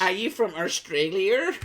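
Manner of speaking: high-pitched mock question; imitated baffled stranger. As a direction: mimic people guessing at an accent. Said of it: "Most people go".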